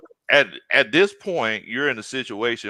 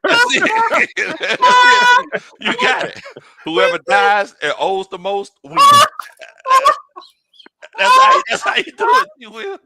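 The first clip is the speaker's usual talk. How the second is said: laughing; 8 dB louder, slower than usual. Real, not acted.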